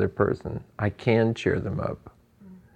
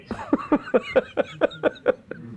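light high laughter at the absurdity; a bright amused laugh. Real, not acted. Laughs after friend's quote.

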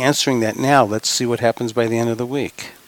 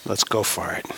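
ordinary delivery

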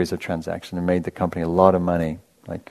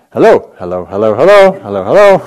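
loud, sing-song Indian vendor calling hello; endearing Indian accent; 3 semitones higher, 12 dB louder than usual. Retold or imitated. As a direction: call it out like an Indian street vendor over a loudspeaker. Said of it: "in that very endearing accent".

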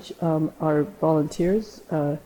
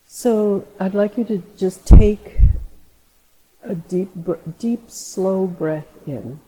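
guided meditation; 4 semitones higher, 7 dB louder, slower than usual. Acted. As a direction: ease them into a slow breath. Leads a meditation.

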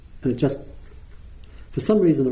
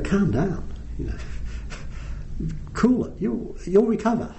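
higher, mock-placating parent voice; soothing parents; 7 semitones higher, 4 dB quieter than usual. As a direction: humour the kid, sing-song and patronising. Parents calming their son.

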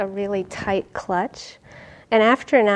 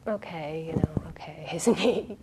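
whispered, softer resigned aside; inner whisper; 4 semitones lower, 5 dB quieter than usual. Retold or imitated. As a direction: mutter it to yourself, resigned.

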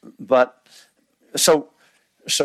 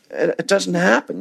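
ordinary talk

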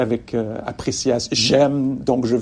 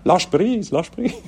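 put-on Québécois accent; 6 semitones higher, slower than usual. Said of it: "avec un accent québécois".

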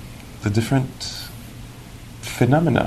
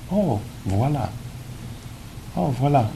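soft recognition; 3 semitones higher, 7 dB quieter than usual. Acted. Acting out calm recognition of phenomena.

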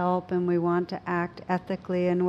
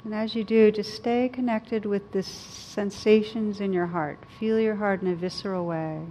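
guided meditation. Acted. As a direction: gently guide them inward.

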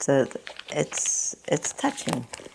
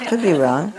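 laughing echo; 3 semitones higher, 3 dB louder than usual. Real, not acted. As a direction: chuckle through "Could be wrong" with a rise. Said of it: "but I could be wrong".

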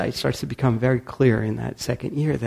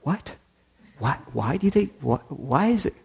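puzzled diners; 4 semitones higher, 3 dB quieter than usual. Retold or imitated. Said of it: "and they were like".

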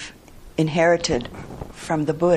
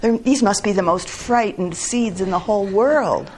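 higher, exasperated lament; understated dismay, pitched up; mild distressed cry; 4 semitones higher than usual. A narrator's reading. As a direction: lament it like a story character at a loss. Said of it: "cried Toad".

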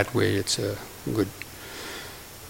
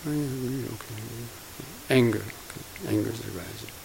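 soft, detached noting to oneself; quiet mental noting; 9 dB quieter than usual. Shown as a demonstration. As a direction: murmur it like a private mental note. Demonstrating silent labelling of thoughts.

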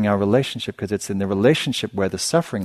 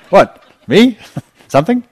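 much higher, louder startled questions; mock surprised; 12 semitones higher, 10 dB louder than usual. Meditator jolted by the loudspeaker, mock startled. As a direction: play dumb as if the speaker called you. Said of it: "You go".

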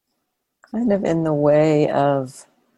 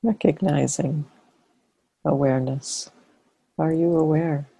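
guided meditation. Real, not acted. Teacher leading a sitting to relax and notice awareness, guided meditation. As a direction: invite them gently into awareness, phrase by phrase.

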